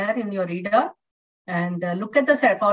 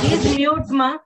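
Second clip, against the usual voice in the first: louder, firmer request to mute; raised tone; 5 semitones higher, 5 dB louder, slower than usual. Real, not acted. Teacher telling student to mute, raised voice. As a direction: call out to mute the mic. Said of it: "I raised my tone".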